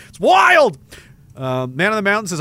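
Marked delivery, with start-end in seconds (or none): 0.1-0.8 sudden loud, much higher shout; a burst of loud, high amazement; shouted exclaim. Real, not acted. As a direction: blurt it out in disbelief. Exclaims after a quiet laugh.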